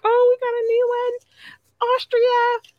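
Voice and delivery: high excited mock voice; excited new-listener voice. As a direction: squeal like a delighted fan spotting something new.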